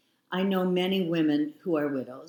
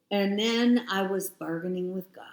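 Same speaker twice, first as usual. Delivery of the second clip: normal talking voice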